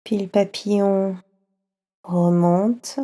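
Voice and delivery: ordinary delivery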